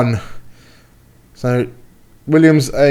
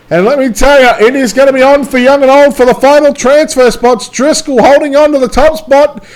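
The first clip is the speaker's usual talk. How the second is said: high, loud race call; live play-by-play; 9 semitones higher, 5 dB louder than usual. Acted. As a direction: build the excitement calling the laps. Excited race call, much higher.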